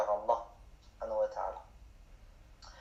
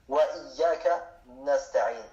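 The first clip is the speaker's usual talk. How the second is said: slow, careful Arabic recitation of Wa iyyaka nasta'in; slow Arabic model; slower than usual. Shown as a demonstration. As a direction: model each syllable of Wa iyyaka nasta'in slowly for students. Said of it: "teacher models the Arabic verse".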